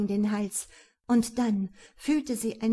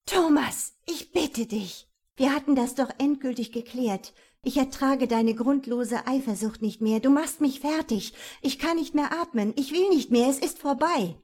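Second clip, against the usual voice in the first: frantic, rising, breathless plea; desperate pleading; 4 semitones higher, 4 dB louder than usual. Acted. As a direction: beg him to stop. Terrified woman pleads with attacker.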